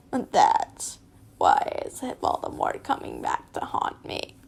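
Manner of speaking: low unvoiced rasp; haunt them like a ghost; spooky raspy voice. Narrator mock-haunting herself as Voldemort. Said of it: "I don't know what that voice is".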